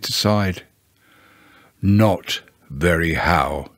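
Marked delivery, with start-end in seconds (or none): 1.8-3.7 low, slow, dejected drag; gloomy donkey. A narrator's reading. Eeyore answering how he is, gloomily. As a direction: drop low and drag each word.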